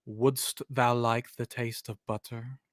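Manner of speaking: low, soft, eerie movie line; softly sinister goat voice; devil's whisper-like hint. Retold or imitated. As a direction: lure them in low and quiet. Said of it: "my hint would have been".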